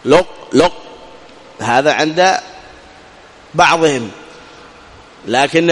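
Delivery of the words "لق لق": لق said with a heavy, emphatic quality lingering; teacher repeating the leftover sound; heavy qaf trace. Shown as a demonstration. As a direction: isolate the remaining qaf quality. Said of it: "سمعنا صوت الصفة".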